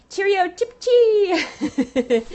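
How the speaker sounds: put-on high, drawn-out English-accent singsong, ending in laughter; mock English accent. Busting out her rusty English accent for viewers, mock English. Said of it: "try to use an English accent".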